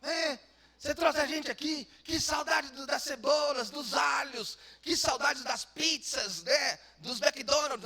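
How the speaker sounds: high, loud complaining voice; hammed-up nagging complainer; whiny crowd. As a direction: wail about missing onions. People whining about Egypt food.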